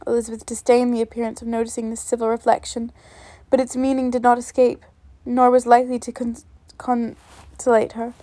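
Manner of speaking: usual speaking voice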